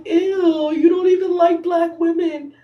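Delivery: high, loud disgusted outburst. Retold or imitated. Reacting to Kendall kissing Garby, high disgusted shout. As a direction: recoil in disgust and call him out. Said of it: "I was like".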